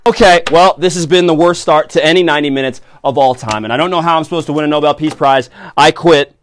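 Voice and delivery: fed-up host ranting; huffy quitting. Acted. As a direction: storm off the set. Host quits in a huff.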